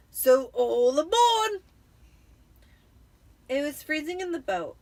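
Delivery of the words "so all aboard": loud, slow, higher call to board; Uncle Vernon calling. A narrator's reading. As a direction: call everyone into the boat. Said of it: "said Uncle Vernon".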